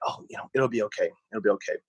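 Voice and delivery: calm your tense husband down; a hint of the wife soothing him; reassuring.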